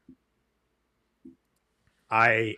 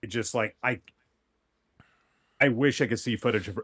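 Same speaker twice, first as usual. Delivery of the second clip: ordinary delivery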